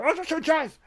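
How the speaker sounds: very high mock outburst; high frantic cry. Jey Uso reacting mid-fight, frantic high mock cry. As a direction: squeal it out in a hammed-up rush.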